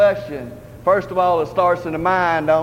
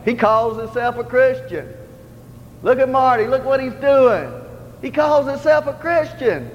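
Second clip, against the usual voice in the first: higher, accusing onlookers pointing fingers; mocking accuser; 5 semitones higher than usual. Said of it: "point a finger to and say".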